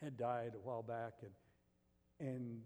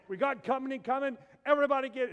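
loud, high alarm call to the family; frantic high-pitched household alert; mom's urgent call; 10 semitones higher, 14 dB louder than usual. Retold or imitated. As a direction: shout it like mom hearing guests are coming. Mom announcing company, urgent.

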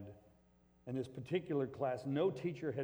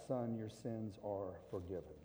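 softer, lower voice, "Son" said gently; tender words; 5 semitones lower, 7 dB quieter than usual. Acted. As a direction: speak it softly, like a father forgiving his son. Jesus speaking gently to the man.

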